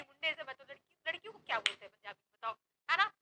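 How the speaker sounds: usual speaking voice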